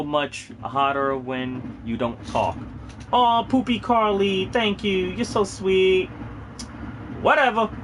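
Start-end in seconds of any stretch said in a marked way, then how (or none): 3.1-7.7 high, slow, sugary mock thanks then a high brush-off; mock flattered. Thanking a teasing viewer in a high mock-sweet voice. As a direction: coo like you're flattered, then shrug it off.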